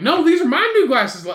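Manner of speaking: high, loud mock protest; retold bickering. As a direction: imitate a stubborn squabbler, amused. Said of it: "back and forth about these glasses".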